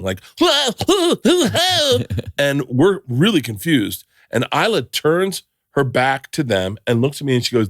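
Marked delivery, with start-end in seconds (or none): 0.4-2.3 high mock yelps of flailing dancers; silly high-pitched hoots; imitated dancing whoops. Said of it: "two dudes in front of us start dancing".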